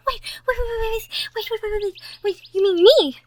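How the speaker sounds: can't believe it's her; breathless shocked stammer building to a cry; stunned disbelief. Acted. Shocked realization of being asked.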